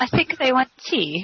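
high, slow mock-British delivery; Eddie Izzard. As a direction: imitate a British officer reporting, drawn out. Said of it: "Eddie Izzard actually addresses that".

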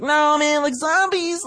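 high-pitched whiny player impression; imitating an insistent casual player; mock protest. Acts out a stubborn casual player.

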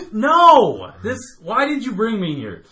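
loud, high-pitched retold objection; outraged protest. Customer to the friend who brought him, outraged. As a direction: burst out with it, high and indignant.

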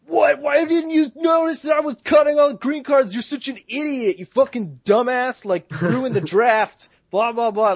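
high, loud furious rant at the player on the left; mock-rage yell at a dumb drafter; imitating a freakout. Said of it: "they freak out".

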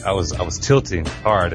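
ordinary talk

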